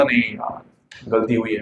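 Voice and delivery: inner voice. Retold or imitated. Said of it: "हृदय अंदर से बोला था".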